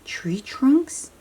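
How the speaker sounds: normal talking voice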